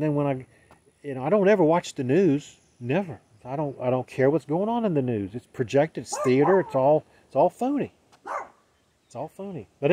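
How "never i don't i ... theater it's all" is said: ordinary delivery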